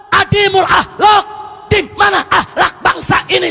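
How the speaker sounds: loud, high, full-force sermon shouting; fiery preaching. Acted. Preacher scolding the congregation, full-force shout. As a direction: thunder at the congregation about shameless sin.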